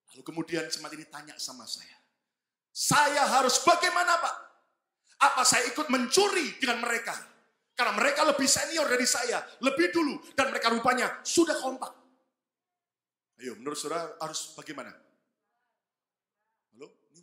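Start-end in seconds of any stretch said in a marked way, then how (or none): 2.7-12.0 high, loud anxious plea; pleading church member. Retold or imitated. Church member begging his pastor for advice, high anxious plea.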